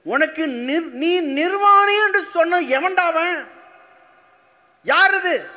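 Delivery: high-pitched, drawn-out angry questioning; acting out an outraged God; indignant demand. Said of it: "எடுத்துன்னு கேட்குறாரு பாருங்க".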